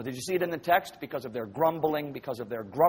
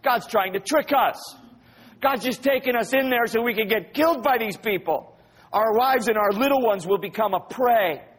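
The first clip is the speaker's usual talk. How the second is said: high-pitched mock indignant complaint; acted outrage, pitched up; accusing; 6 semitones higher than usual. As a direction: accuse like an aggrieved grumbler. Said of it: "That's a strong accusation against God".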